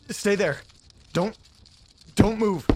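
tense, high-pitched plea to stay put; scared order to a bug that must not move; nervous command. Acted. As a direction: hold your breath and warn it off.

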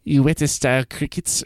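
put-on Weta said WIT-uh in a half-hearted New Zealand accent; attempted New Zealand accent. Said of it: "I can't do it".

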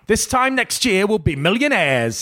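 high, hammed-up cockney optimist; Only Fools and Horses trader. Trader reassures dying sister, high mock bravado. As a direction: imitate a sitcom wheeler-dealer's big promise. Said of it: "That's from Only Fools and Horses".

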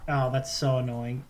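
ordinary talk

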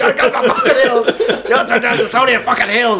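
high, laughing rant; hammed-up drill order; mock yelling. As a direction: herd them along with pretend fury. Mock row over the route.

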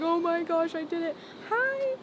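high, squeaky overwhelmed joy, half crying; retelling a tearful phone call, high and overwhelmed; tearful disbelief. Retold or imitated. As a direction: replay the moment you cried with happiness in the car. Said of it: "crying, like, crying in my car".

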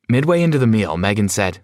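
usual speaking voice

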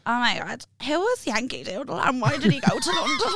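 high dopey voice, laughing through it; woozy on morphine. Retold or imitated. [name] imitating herself high on morphine after surgery, dopey and high. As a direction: sound drugged and puzzled. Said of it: "I woke up and I was like".

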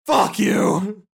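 wounded prisoner's furious outburst; angry curse. Acted. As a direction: spit it at the captor, strained. Stabbed prisoner swears back.

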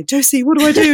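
high, loud mock plea for help; desperate plea. Mother pleading with her pocket parenting coach, mock panic. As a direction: cry out to Josie in your pocket in mock panic.

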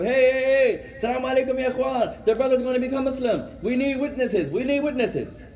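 high-pitched rallying call; hammed-up urgent summons; calling people over. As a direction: round everyone up as witnesses. Acts gathering witnesses, calling out.

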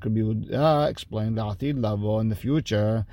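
ordinary talk, nothing marked

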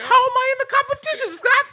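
very high, fast mock holler; rushed, high-pitched hammed-up protest; imitated yelling. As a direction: mimic an outraged shout across the field. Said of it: "this guy is yelling at me".

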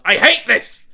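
loud frustrated yell, high and loud. Retold or imitated. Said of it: "and I yelled".

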